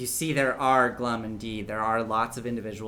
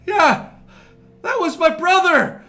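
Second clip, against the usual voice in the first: high, loud outraged cry; grieving bystander; 15 dB louder than usual. Acted. Crowd member yelling about his dead brother, high and loud. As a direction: shout it high from the back of the crowd. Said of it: "somebody else in the crowd goes".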